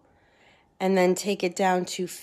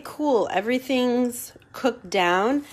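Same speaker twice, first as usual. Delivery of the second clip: ordinary talk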